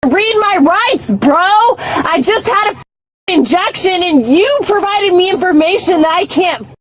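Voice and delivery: high-pitched, heated retort; indignant outburst. Real, not acted. Detained driver explaining trouble reading rights, indignant.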